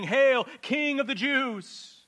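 high, loud jeering hail; mocking soldiers. Preacher voicing the soldiers' jeering salute. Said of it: "they were saying".

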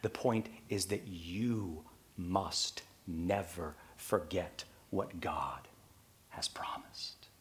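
slow, dropping to a whisper; hushed, urgent sermon point; intense hush. Real, not acted. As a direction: lean in and whisper the promise. Drops to make key point.

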